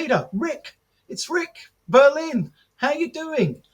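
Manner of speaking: high-pitched, insistent hello; eager greeting. Retold or imitated. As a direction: remind him who you are, eagerly. Eager greeting to someone who forgot him.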